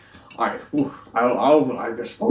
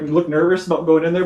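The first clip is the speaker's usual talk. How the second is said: ordinary talk, nothing marked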